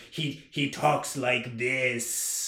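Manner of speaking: very slow, drawn-out villain voice; Snape from the audiobook. Retold or imitated. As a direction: imitate a narrator's overdone sinister character. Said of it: "when Snape opens his mouth in this book".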